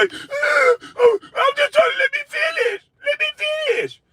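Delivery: high-pitched, hammed-up tearful protest; mock him with a shrill, crying plea to be heard; mocking whine. Ranting at a TV panelist, imitating his flustered protest, mocking high whine.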